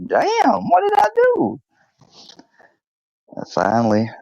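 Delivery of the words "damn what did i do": very high, wailing dog voice; mock-wounded squeal of innocence; bewildered dog. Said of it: "this look on his face like".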